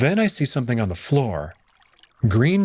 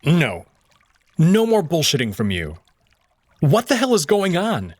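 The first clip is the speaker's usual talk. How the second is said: angry demand; 6 semitones higher than usual. A narrator's reading. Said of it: "I glare".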